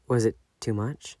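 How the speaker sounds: usual speaking voice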